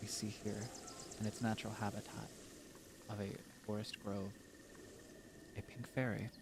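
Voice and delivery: soft, hushed wildlife narrator; nature documentary narrator. Acted. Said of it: "monologuing as a, like, a nature documentarist".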